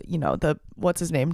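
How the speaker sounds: normal talking voice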